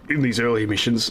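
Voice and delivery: talking through a burp. Real, not acted. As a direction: burp slipping into the words. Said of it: "I'm burping a bit".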